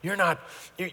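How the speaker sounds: ordinary talk, nothing marked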